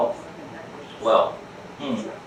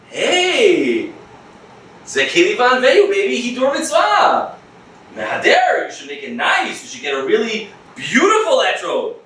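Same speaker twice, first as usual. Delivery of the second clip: higher, hyped sales pitch; pushy salesman; 6 semitones higher than usual. Retold or imitated. Etrog seller pushing the pricey fruit on the customer. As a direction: sell it hard, hawker style. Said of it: "seller's sales pitch".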